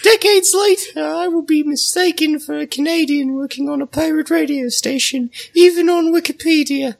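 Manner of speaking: put-on very high, loud squeaky British boy; over-the-top high British child voice; Cockney small boy voice. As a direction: keep the shrieking little Cockney lad going. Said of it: "I'm a very convincing small British boy".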